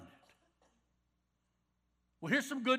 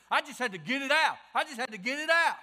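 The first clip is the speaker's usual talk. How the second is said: loud mock venting, louder and higher; 6 semitones higher, 11 dB louder than usual. Preacher mocking people who vent, loud. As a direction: shout it like someone blowing off steam. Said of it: "you've heard people say".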